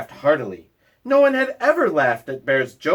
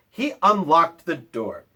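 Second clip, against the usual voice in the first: ordinary delivery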